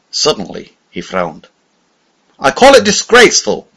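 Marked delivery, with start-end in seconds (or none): 2.4-3.7 loud, high, indignant rebuke; raised voice. A narrator's reading. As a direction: raise your voice in disgust. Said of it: "he said, raising his voice".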